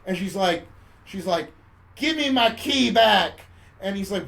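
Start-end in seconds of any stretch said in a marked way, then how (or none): 1.9-3.4 loud, high, drawn-out demand; mock breakup demand. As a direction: act out an angry girlfriend slowly. Woman breaks up in film retelling.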